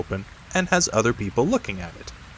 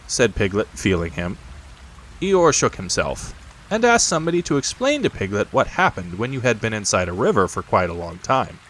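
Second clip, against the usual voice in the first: plain reading voice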